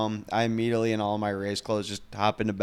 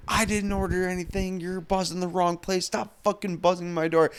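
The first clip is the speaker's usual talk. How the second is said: annoyed neighbor; 8 semitones higher than usual. Retold or imitated. Annoyed neighbor yells from window.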